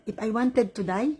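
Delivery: usual speaking voice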